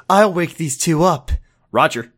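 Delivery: ordinary talk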